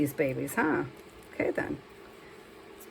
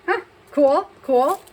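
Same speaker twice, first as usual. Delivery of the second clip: higher and louder, delighted; pleased surprise; 6 semitones higher, 6 dB louder, slower than usual. Real, not acted. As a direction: caught off guard by a good print. Reacts to print result.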